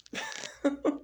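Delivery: high-pitched laugh. Real, not acted. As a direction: laugh at your own bit, staying high. Laughs after his own skit.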